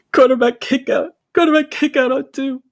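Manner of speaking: imitated crying, very high and loud; mock-sob the play call in a high squeal; tearful play-calling. Tearful young quarterback calling plays to his team, mock crying. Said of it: "I'm literally tearing up as I'm calling plays".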